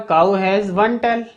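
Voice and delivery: cow has one tail said very slowly word by word; each word of the sentence clearly separated; slow dictation. Shown as a demonstration. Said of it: "यहां पर क्या आ जाएगा". (बहुत धीरे, एक-एक शब्द अलग बोलकर)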